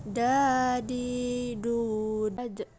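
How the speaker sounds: "Da, di, du" drawn out, ending on an echoing d; long vowels on "Da, di, du", final d bounced; bouncing d. Shown as a demonstration. Teacher shows dal, "Da, di, du" long with a bouncing close. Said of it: "huruf da terdengar memantul".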